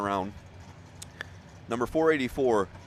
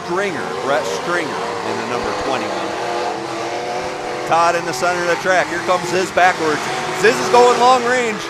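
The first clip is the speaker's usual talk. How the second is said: rising, loud play-by-play; excited commentary; 6 semitones higher, 7 dB louder than usual. Real, not acted. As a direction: call the derby action loud and high. Live derby action.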